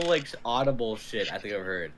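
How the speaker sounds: usual speaking voice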